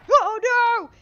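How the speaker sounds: loud, high wail of dismay; dismayed cry. Real, not acted. Getting hit by the forgotten attack, a loud high wail. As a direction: cry out at the mistake. Enemies spawn on her.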